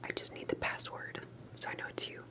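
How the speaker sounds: ordinary talk, nothing marked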